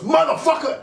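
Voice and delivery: high, loud furious yell; mock angry shout. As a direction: bellow it like you've just been conned. [name] realising he was conned.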